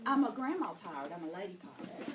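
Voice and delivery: normal talking voice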